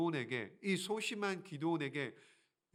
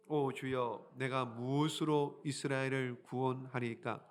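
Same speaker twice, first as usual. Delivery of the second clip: low, measured reading; lower, steady Bible reading; scripture reading; 4 semitones lower, slower than usual. Acted. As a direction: read the verse aloud solemnly.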